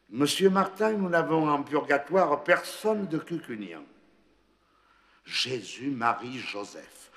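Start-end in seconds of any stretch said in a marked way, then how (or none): 0.0-3.8 laughing angel. A narrator's reading.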